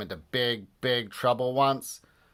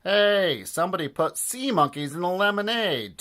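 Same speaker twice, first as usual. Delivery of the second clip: shouting; 8 semitones higher than usual. Acted. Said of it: "people shouting".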